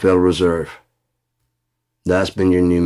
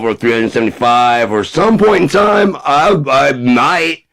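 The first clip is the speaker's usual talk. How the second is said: higher, louder Trump caricature rambling; Trump impression; 8 semitones higher, 7 dB louder, slower than usual. Comic Trump dodging the files question, loud bluster.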